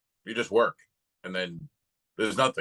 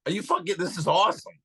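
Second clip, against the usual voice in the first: delighted inner thought; 6 semitones higher, faster than usual. Retold or imitated. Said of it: "and I'm thinking".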